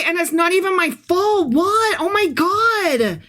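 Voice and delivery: high, whining complaint; high-pitched whiny protest; whiny Amos. Acted. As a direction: bitch and moan like a put-upon prophet. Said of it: "adding that inflection to this voice".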